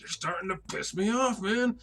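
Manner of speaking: high, strained annoyance; comically fed up; mock irritation. [name] loses patience mid-fight.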